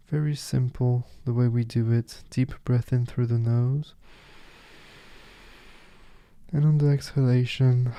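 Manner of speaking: soft, unhurried meditation guide, with long pauses; softly spoken guide leaving space between phrases; guided meditation. Acted. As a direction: settle the listener gently into stillness.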